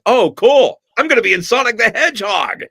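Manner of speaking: high, loud, thrilled outburst; excited exclamation. Retold or imitated. Actor thrilled he's landed a Sonic game, high excited exclamation. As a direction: burst out high with mock-giddy excitement. Reenacts his excited reaction on arriving.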